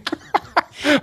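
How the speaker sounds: high-pitched burst of laughter. Real, not acted. Laughing at the joke.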